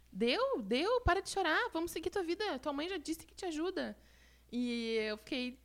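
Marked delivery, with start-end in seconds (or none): 0.1-4.0 higher, coaxing friend telling her to stop crying; lightly voiced friend calming her down; friend's comforting voice. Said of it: "e ele dizia". (amigo consolando, voz mais aguda e suave)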